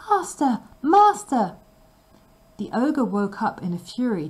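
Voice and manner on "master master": much higher, loud cry; harp calling out. A narrator's reading. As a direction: cry out high for help. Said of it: "the harp called out".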